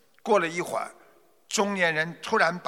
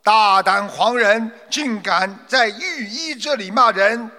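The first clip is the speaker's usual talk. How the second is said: stern rebuke; 5 semitones higher, 6 dB louder than usual. Acted. Household guards sternly rebuke the shouting merchant. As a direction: put the loudmouth in his place. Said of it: "呵斥道".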